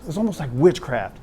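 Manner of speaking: ordinary talk, nothing marked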